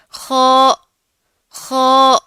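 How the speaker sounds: خو with a full mouth, loud; heavy kho. Shown as a demonstration. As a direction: fill the mouth on خو. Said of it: "heavy آواز کے ساتھ". (بھاری آواز میں خو)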